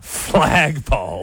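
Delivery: slow, drawn-out presidential impression; Donald Trump. Radio host mimicking the President showing off a flagpole, drawn-out impersonation. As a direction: stretch the word out like a hammed-up politician. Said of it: "your Trump impersonation".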